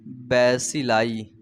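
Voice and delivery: Bacilli stretched slowly and low, each syllable clear; slow dictation of bacilli; term drawn out. Shown as a demonstration. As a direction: spell out bacilli slowly for the class. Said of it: "rod shape bacteria को बोलते हैं".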